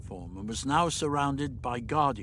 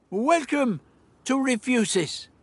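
high, grand welcome; showy, high-pitched greeting; speaking up; 12 semitones higher, 4 dB louder than usual. Acted. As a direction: hail the guard like a genial host. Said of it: "He spoke up as he addressed".

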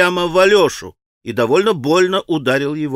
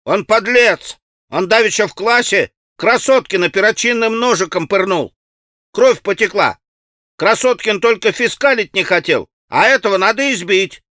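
put-on higher, aggrieved boyish accusation; irritated child's voice; 5 semitones higher, 3 dB louder than usual. A narrator's reading. Boy in red shirt accusing the stone thrower, irritated. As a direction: tattle indignantly like a cross schoolboy. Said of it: "раздражённым детским голоском".

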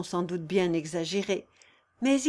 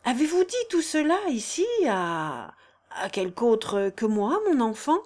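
hesitant questioning; 3 semitones higher than usual. A narrator's reading. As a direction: probe cautiously, breaking off midway. Count anxiously questions Consuelo after revelation.

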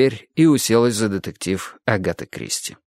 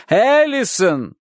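higher slow drawn-out call; calling higher tearfully; 11 semitones higher, much slower than usual. A narrator's reading. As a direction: call again more desperately. Repeated louder.

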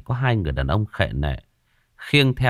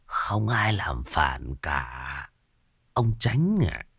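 very slow, whispered and much softer; sinister whisper; 6 semitones lower, 7 dB quieter, much slower than usual. A narrator's reading. Sorcerer Khoan whispers slowly to the old official, menacing. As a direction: breathe the threat slowly.

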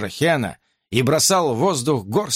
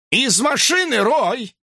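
police command shout; 10 semitones higher, slower than usual. A narrator's reading. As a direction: bark the order. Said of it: "скомандовал полицейский".

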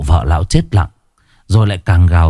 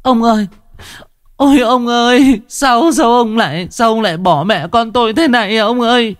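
throat-tearing wail; 14 semitones higher than usual. A narrator's reading. Wife cries out to her dead husband, wailing in grief. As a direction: wail like a newly bereaved wife. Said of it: "tiếng gào xé họng".

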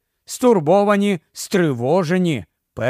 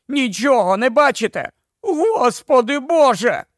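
panicked outcry; 8 semitones higher than usual. A narrator's reading. Ed panics at the crowd.

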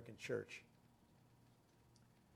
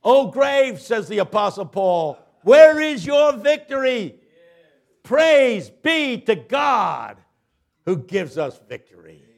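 higher, louder, slow thundering sermon; preaching at full force; 10 semitones higher, 18 dB louder, much slower than usual. Acted. Preacher thundering Paul's words to the congregation, full force. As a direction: roar the scripture at the congregation.

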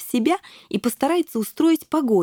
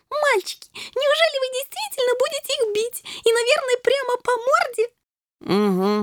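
put-on very high, gleeful girlish voice; delighted little princess. A narrator's reading. Shelli eagerly asking boys about the fight, gleeful squeal. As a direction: ask eagerly, squeaky with excitement.

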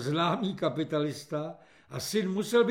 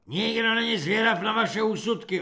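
raised, loud rebuke; snapping; 5 semitones higher, 5 dB louder than usual. A narrator's reading. Corporal turning on commenting recruits, snapping. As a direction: snap at them to shut up. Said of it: "osopl se na ně Halík".

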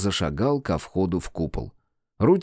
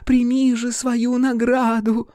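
high, slow fairy voice; pixie; 12 semitones higher, slower than usual. A narrator's reading. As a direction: languid little fairy purr. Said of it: "томный голос фейки".

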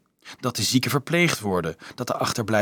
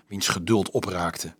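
plain reading voice

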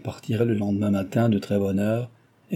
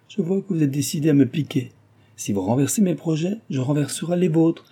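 higher-pitched, animated retort; exclaiming; 7 semitones higher than usual. A narrator's reading. Milady threatening to upset Cécile's plans, exclaiming. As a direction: push back with raised pitch. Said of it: "s'écria-t-elle".

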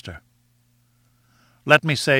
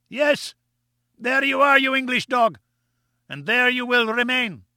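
high, taunting villain voice; gloating villain; 10 semitones higher than usual. A narrator's reading. Gomez jeering at the stranded explorers, high gloating taunt. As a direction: sneer your revenge at them from above.

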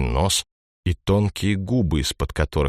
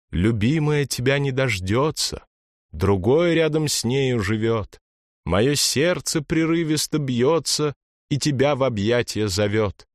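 light, higher siren voice; mermaid's thin voice; 7 semitones higher than usual. A narrator's reading. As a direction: lure him with a thin voice. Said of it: "Послышался тонкий голос". (тонкий голос русалки)

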